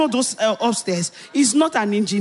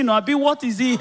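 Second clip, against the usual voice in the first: normal talking voice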